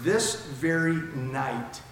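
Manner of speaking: each word drawn out slowly; slow, deliberate. Shown as a demonstration. Preacher unpacking the phrase, slow and weighty. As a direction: linger on every word of "This very night". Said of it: "phrase by phrase".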